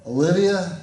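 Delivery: loud roll-call shout with rising pitch. Real, not acted. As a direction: call the name out across a classroom. Calling the roll in class.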